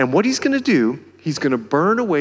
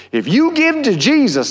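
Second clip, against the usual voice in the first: mocking country preacher accent, much higher and louder; hammed-up country preacher accent, high and loud; 10 semitones higher, 6 dB louder than usual. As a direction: belt it like a down-home country preacher mocking prosperity talk. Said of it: "sorry for the country accent".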